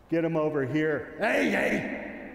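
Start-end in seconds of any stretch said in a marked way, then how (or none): 1.2-2.3 high, sharp call to stop the dog; sharp shout at dog. Real, not acted. Handler correcting his dog from a distance, sharp high shout. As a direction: snap the dog out of it with a high yell.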